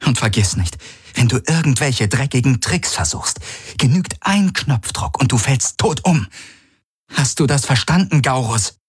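threatening. A narrator's reading. Threatening the creature.

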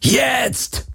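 very high, drawn-out cry; roar. A narrator's reading. Galen signals Kirai to run, roared command. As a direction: yell the signal with a leaping pitch. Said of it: "brüllte Galen".

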